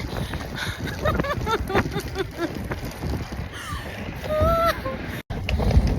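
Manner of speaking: breathless laughter. Real, not acted. Laughing at own struggle.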